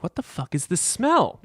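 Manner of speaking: high, slow alarmed "what the fuck"; a sniff of panic at a growing stink; alarmed disbelief. Retold or imitated. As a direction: drag it out in shocked disbelief. Retold rising alarm at smell.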